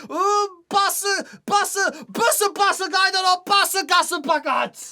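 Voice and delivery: high, theatrical chanting delivery; hammed-up tongue twister. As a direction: pitch it high and punch out each word.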